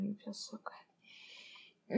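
very soft, slow whisper; intimate whisper. Pretend. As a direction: sigh it out slowly. Intimate roleplay.

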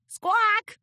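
loud high bird squawk; chicken squawk. Acted. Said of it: "she giggled".

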